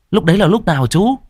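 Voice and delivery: put-on high, teasing boy's voice; cheeky nephew. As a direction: poke fun at the uncle innocently. Boy presses.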